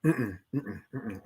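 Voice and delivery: quiet savoring "mm-mm" hums; quiet sipping hums; demo sip sounds. Shown as a demonstration. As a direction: hum low and soft while you sip. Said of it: "This is how we sip".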